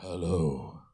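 very low, drawn-out flirty hello; voice dropped for a sweetheart. Shown as a demonstration. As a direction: answer deep and smooth for your beloved. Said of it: "your voice drops two octaves".

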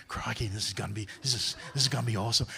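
low, soft, hushed Australian nature-host voice; hushed mock Aussie croc hunter; Crocodile Hunter impression. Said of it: "she crocodile hunter or something".

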